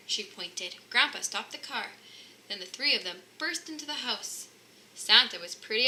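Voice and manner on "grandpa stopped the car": ordinary delivery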